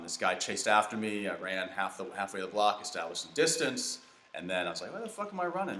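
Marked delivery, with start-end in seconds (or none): 4.9-5.8 high-pitched incredulity. Retold or imitated. Acts out his indignant thought mid-chase.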